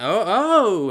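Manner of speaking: loud, high yelp of alarm; startled cry. Retold or imitated. Woman as knife falls on her lap, startled yelp. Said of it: "she went".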